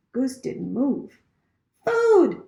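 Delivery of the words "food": high, louder chick cry; a starving chick squeaking for food; hungry gosling. A narrator's reading. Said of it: "peeped the gosling".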